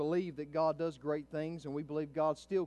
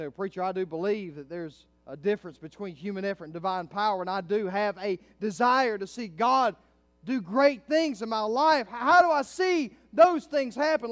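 building to a high, loud cry; impassioned preaching; 6 semitones higher, 12 dB louder than usual. Real, not acted. Preacher voices the church's desire for God, building fervently. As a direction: drive it up to full-force preaching.

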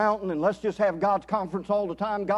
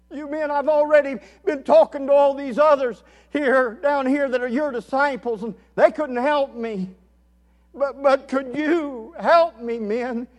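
higher-pitched retold plea of a desperate father; pleading; 6 semitones higher, 6 dB louder than usual. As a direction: press the men urgently for help. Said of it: "He's pleading".

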